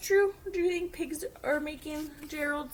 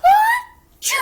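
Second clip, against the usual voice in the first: very loud, much higher ah, chew; huge sneeze; 21 dB louder than usual. Acted. Gerald sneezing at Piggy, huge and loud. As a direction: explode into the biggest sneeze.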